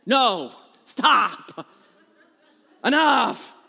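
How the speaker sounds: loud, punched commands; acted parental outburst; exasperated dad. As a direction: put your foot down over the racket. Dad ordering noisy kids to stop.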